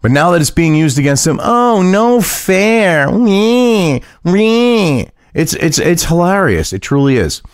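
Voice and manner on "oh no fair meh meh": high, drawn-out whiny mimicry; mock whining. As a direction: stretch it out like a sulky, bleating complainer. Mocks Democrats as whining children.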